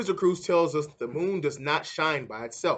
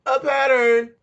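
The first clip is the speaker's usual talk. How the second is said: excited exclamation; 8 semitones higher, 8 dB louder than usual. Acted. Akiko sums up the chant.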